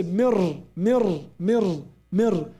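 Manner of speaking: mir repeated with the r right after the m; drilled mir. Shown as a demonstration. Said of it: "I moved from mim right away to ra".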